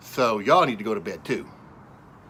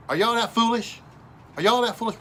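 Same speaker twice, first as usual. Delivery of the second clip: much higher, exasperated challenge; incredulous scolding; 11 semitones higher, 3 dB louder than usual. Real, not acted. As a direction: demand it in disbelief. Scolding the audience.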